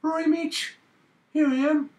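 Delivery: very high Geordie caricature; squeaky put-on Newcastle voice; Newcastle accent. Shown as a demonstration. Friend having another go at the accent, high Geordie squeal.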